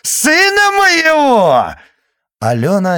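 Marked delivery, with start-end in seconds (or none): 0.0-1.7 much higher, louder frantic shriek; screaming. Acted. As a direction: scream at the top of her lungs. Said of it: "надрывалась она".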